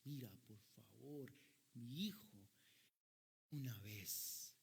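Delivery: súplica contenida y lenta; ruego de padre. Acted. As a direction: ruega despacio por tu hijo, sin alzar la voz. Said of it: "el padre rogó al juez". (ruego paterno pausado)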